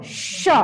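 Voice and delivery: "श" as a drawn-out hissing breath of friction; fricative demo. Shown as a demonstration. Teacher demonstrating a fricative to the class, isolated "श". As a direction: sound out "श" on its own with rubbing air. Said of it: "उच्चारण करके समझ लेते हैं".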